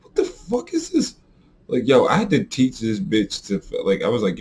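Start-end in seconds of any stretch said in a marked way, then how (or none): 0.0-1.2 shocked outburst. Retold or imitated. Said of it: "you would be like".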